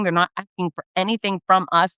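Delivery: ordinary delivery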